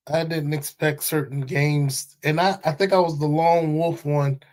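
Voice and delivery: ordinary talk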